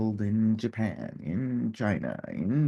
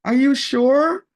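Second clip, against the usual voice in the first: very high, loud falsetto, honey-sweet fake innocence; mock sweet teasing; 12 dB louder than usual. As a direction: tease the husband with exaggerated sugary disbelief. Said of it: "because I was laughing".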